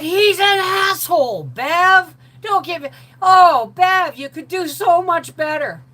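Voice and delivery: high, loud, slow shouted warning; alarmed warning. Retold or imitated. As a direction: scream the warning at your friend. Angie warning her off.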